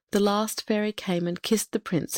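ordinary delivery, nothing marked

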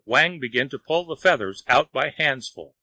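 ordinary delivery, nothing marked

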